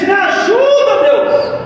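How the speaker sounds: high, loud desperate plea; cry for help. Retold or imitated. Voices people crying to God. (clamor alto e agudo)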